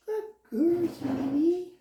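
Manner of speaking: high, doting praise; high sweet praise. Real, not acted. Trainer doting on the dog after a good sit, high and sweet. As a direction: gush over her like a pet.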